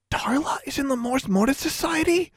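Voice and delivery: high, breathless shocked whisper; gasped whisper. A narrator's reading. Girl learning a classmate's secret, gasping in disbelief. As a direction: gasp it into a friend's ear. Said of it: "Anna gasped in her ear".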